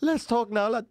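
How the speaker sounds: high, loud, hammed-up Scouse taunt; Paddy Pimblett. Victorious fighter taunting his downed opponent, high mock Scouse voice. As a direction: crow it out in a squeaky put-on Liverpool voice. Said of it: "What does he say to him now?".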